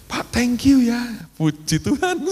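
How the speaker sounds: high-pitched put-on thanks; mock gratitude. Acts out rueful thanks on phone.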